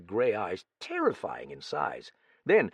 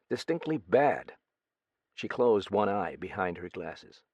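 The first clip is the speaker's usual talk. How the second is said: plain reading voice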